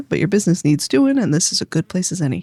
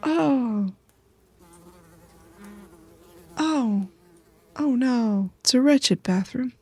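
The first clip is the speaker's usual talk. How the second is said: disgusted dismay; 3 semitones higher, slower than usual. Real, not acted. Player recoiling at the wretched bathroom, disgusted. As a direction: recoil at the filth.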